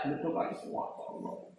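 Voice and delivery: hushed whispered aside; breathy whispered sigh to God. Real, not acted. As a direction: drop to a whisper. Aside about firecrackers.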